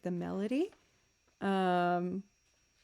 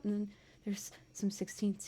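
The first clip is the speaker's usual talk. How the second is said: whispering; 8 dB quieter than usual. Retold or imitated. Acting out her inner thoughts.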